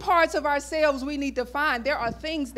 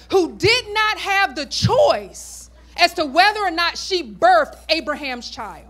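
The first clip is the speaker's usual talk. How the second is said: louder, higher preacher's build; preaching at full force; 5 semitones higher, 6 dB louder than usual. Acted. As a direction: drive it home to the congregation.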